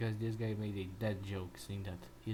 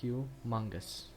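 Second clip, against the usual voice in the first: slow, drawn-out word; re-enacted answer; much slower than usual. Trying to say the man's name from the clip, slow re-enacted answer. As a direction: give the name slowly and deliberately. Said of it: "I will try to say it".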